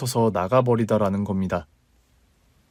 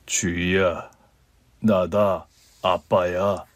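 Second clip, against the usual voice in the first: put-on low, hesitant older man's voice; lower, careful voice of an estranged father; old father on the phone; 6 semitones lower, much slower than usual. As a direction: speak like a father reaching out after twenty years. Father calls after years.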